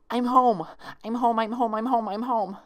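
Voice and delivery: high, frantic chant of relief; rapid repeating. A narrator's reading. Twilight waking in her own bed, high frantic chanting. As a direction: repeat it fast like a mantra. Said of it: "Twilight repeated rapidly under her breath".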